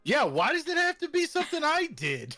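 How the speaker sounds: very high-pitched squeaky voice; squeaky voice changer pitch; high squeak. Real, not acted. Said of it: "I made him squeak".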